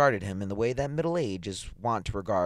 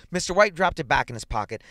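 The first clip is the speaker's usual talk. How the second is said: ordinary delivery, nothing marked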